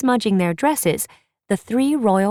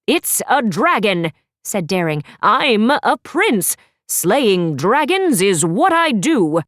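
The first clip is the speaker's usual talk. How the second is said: cocky prince; 2 semitones higher, 4 dB louder, slower than usual. Acted. Daring voiced boasting.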